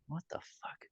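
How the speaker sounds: breathless whispered disbelief; stunned whisper. Retold or imitated. Seeing two cops outside, a stunned whisper. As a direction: whisper it in shock at the door.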